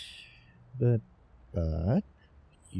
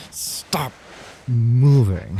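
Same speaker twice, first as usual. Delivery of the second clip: whispered stop bursting into a loud moving; sudden hushed-then-loud plea; urgent stop; 5 semitones higher, 10 dB louder, slower than usual. Acted. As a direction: cut him off sharply. Calming a frightened person.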